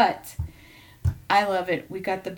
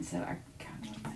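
whispered aside; 14 dB quieter, faster than usual. Real, not acted. Quiet aside about wanting more.

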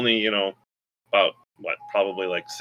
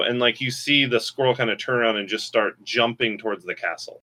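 ordinary talk

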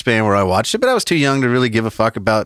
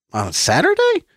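surprised question; 11 semitones higher than usual. Retold or imitated. Surprised at notification.

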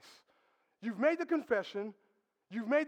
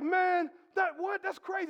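mock outrage; 7 semitones higher, 3 dB louder than usual. Barry's reaction to no hunting.

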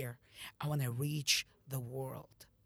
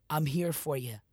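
normal talking voice

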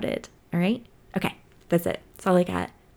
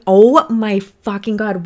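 ordinary talk

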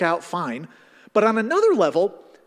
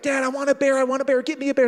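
put-on higher, whiny little-kid voice; begging kid; 7 semitones higher, faster than usual. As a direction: plead for the teddy bear like an eager child. Kid begging dad for bear.

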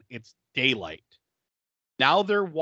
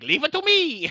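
higher, louder, gung-ho offer; eager hero cry; 11 semitones higher, 4 dB louder than usual. Retold or imitated. Dwarf volunteers to take out the guard, higher eager cry. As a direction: shout it out like a plucky sidekick. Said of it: "And Vasile's like".

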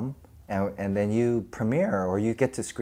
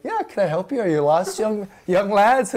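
lightly done, higher sweet helper voice; overly nice; 7 semitones higher, 4 dB louder than usual. Retold or imitated. Roger answering the strangers, a hint of overly polite voice. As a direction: offer help with exaggerated kindness. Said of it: "he's trying to be so nice".

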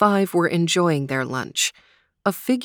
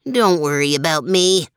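put-on amused old dog; 4 dB louder than usual. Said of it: "the old dog laughed".

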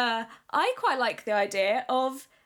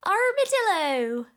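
higher, silly sing-out; funny voice; 4 semitones higher than usual. Shown as a demonstration. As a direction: put on a high comic voice for it. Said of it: "Everyone say armadillo in a funny voice".

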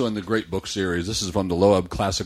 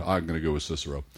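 usual speaking voice

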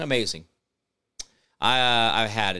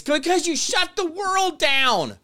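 very high, drawn-out yell; long furious high shout; emotional outburst; 13 semitones higher, slower than usual. Real, not acted. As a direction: wail the accusation at full stretch. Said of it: "I got emotional there".

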